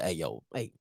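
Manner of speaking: low, soft mock recruiter; a hushed, buddy-buddy pitch; Southern pitching to Coach Dancy, low and chummy; imitating the recruiter. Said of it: "I'm like".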